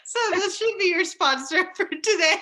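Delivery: high, loud voice full of laughter; real giggles through the words; laughing joke. Real, not acted. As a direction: crack yourself up while joking. Joking while laughing.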